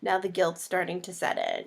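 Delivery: normal talking voice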